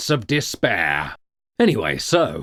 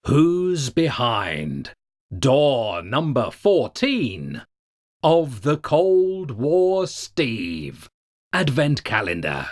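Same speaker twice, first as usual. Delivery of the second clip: slow announcer reveal; slower than usual. Acted. Game-show style door reveal.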